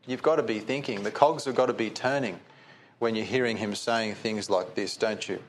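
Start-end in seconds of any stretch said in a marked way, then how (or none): none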